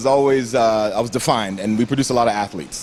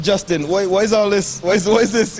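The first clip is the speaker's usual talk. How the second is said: put-on higher-pitched, baffled Grenadian accent; thick home accent; 7 semitones higher than usual. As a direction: imitate your younger self asking in Grenadian accent. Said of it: "So with my accent".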